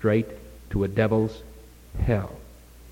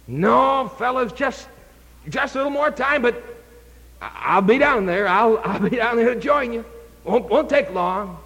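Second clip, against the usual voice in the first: high, eager rambling excuses; 9 semitones higher than usual. Retold or imitated. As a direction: talk yourself into waiting, pitched high. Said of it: "Come on, Doug".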